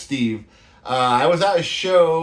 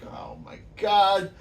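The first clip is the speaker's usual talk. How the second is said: slow, higher stunned "oh my God"; shocked gasp; 5 semitones higher, 4 dB quieter, slower than usual. Retold or imitated. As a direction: caught off guard by the news, drawn out. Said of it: "I'm like".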